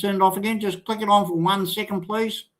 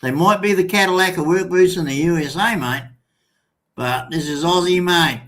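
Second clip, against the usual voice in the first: normal talking voice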